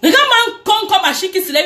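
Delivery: fast, high, heated rant; angry outburst. Real, not acted. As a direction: spit it out furiously, fast. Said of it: "Je suis énervée".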